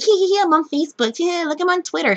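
high, loud, fast silly giggling voice; mock the airheaded moviegoer on her phone; mocking phone user. Mimicking a giggly woman on her phone in the theater, high mocking voice. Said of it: "Be like".

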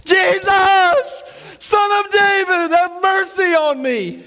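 very high, loud, drawn-out cry. Acted. Bartimaeus shouting again to Jesus, high loud cry. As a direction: keep crying out over the crowd. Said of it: "He shouted all the more".

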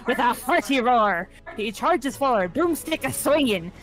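lively pirate play-by-play of a battle; terrible pirate accent. Acted. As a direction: call the fight as a hammed-up pirate captain. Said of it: "forgive me for my terrible pirate accent".

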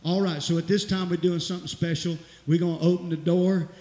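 loud, rousing preacher call; preaching at full force. Real, not acted. Pastor calling the church to the altar, loud preaching. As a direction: lift the whole church, full voice.

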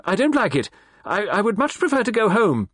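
high, loud, wailing protest. A narrator's reading. Mrs Ackroyd to Poirot, wailing to leave. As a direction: wail it out in panic. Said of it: "she wailed".

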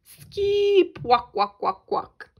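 high chirped call fading to repeated walk; bird's call. Acted. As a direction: chirp and echo it like a bird. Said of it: "replied the bird".

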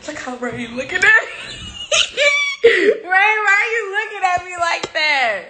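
shrieking laughter, high and loud; laughing hard. Real, not acted. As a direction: crack up at him. Laughing at Ray's stare.

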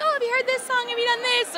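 high-pitched imitation of excited chatter; high, eager mock questions; eager sharing. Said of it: "showing each other, like".

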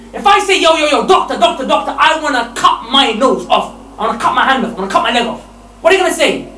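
loud, rising sermon voice; fiery preaching. Acted. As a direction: preach it hard at the crowd. Preacher building to loud climax.

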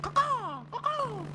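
very high shrill hawk screech; shrill screeching bird woman. Shown as a demonstration. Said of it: "the caw-caw is really more, you know, like a hawk".

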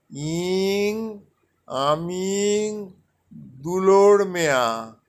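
আমিং stretched out slowly, then দুলোর Mia said firmly; teacher repeating the phrase slowly for memorising; slow Santali drill. Shown as a demonstration. Teacher has learners repeat the Santali sentence, slow. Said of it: "তাহলে হচ্ছে দেখুন".

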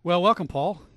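big, high on-air greeting; radio host hailing his co-host, loud and high; loud welcome. Real, not acted. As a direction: boom out the welcome.

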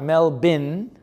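Melbourne said as mel-BIN; slow Melbourne ending like a rubbish bin; wrong, "bin" ending. Shown as a demonstration. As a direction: stretch Melbourne out with a clear "bin" at the end. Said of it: "some kind of a bin".

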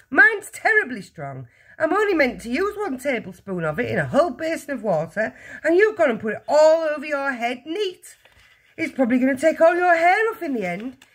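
high, loud exasperated lecture; scolding. A narrator's reading. Mother warns husband about her strong peroxide, scolding.

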